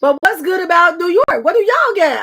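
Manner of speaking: pressing. Real, not acted. Host presses guest on New York.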